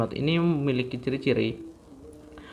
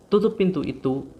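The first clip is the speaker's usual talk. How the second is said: loud, high, sharp order to shut the door; firm command; 6 semitones higher, 5 dB louder than usual. Shown as a demonstration. Teacher demonstrating a command sentence to students, loud and high. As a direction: bark it out as a demo command. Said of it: "mempunyai pola intonasi yang tinggi saat diucapkan".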